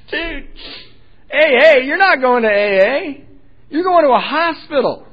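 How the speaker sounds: high, loud mocking incredulity; sarcastic brother. Sarcastic brother laughing in his face over A A, loud high mockery.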